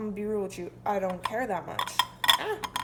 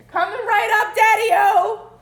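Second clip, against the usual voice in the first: excited shout; 11 semitones higher, 15 dB louder, slower than usual. Acted. Answering dad's order, playful call.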